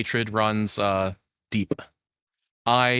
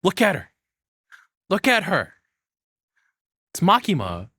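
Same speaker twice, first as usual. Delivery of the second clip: louder, higher, delighted pointing; gushing; 10 semitones higher, 4 dB louder than usual. Real, not acted. Streamer gushing at an anime character on screen. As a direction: swoon over the picture on screen.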